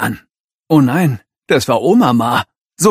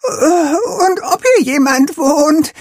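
high, trembling indignant voice; shaky voice; 4 dB louder than usual. A narrator's reading. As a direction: voice quivering with shock. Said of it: "mit bebender Stimme".

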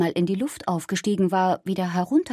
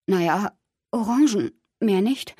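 choked voice; 2 semitones higher than usual. A narrator's reading. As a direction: answer haltingly, as if a little choked. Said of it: "antwortete mit erstickter Stimme". (leicht erstickte, zögerliche Stimme)

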